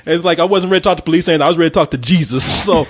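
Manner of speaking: ordinary delivery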